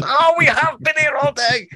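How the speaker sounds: very high, loud mock wail; exasperated cry. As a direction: cry out in exaggerated dismay at the lost day. Bunny finds night has fallen.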